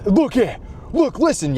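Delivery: high, loud imitated yell at the chat; mock yelling. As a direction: bark it at the chat like a furious streamer. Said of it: "yelling at the chat".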